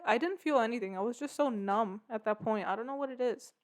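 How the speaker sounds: ordinary talk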